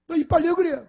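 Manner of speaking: high, loud parental outcry; alarmed yell. Retold or imitated. 부러진 이빨을 본 부모가 아들에게 소리치듯, 놀라 외치는 고함. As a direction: yell it like a shocked parent spotting the damage. Parents discover broken tooth.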